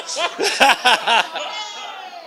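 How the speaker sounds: loud, high laugh; real high-pitched cackling laugh; burst of laughter. Real, not acted. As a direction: burst out laughing at your own joke. Laughs at his own quip.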